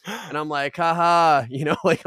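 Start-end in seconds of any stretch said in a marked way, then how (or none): 0.8-1.5 loud, forced ha-ha; fake polite laugh. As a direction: imitate humouring someone with a laugh. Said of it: "like that".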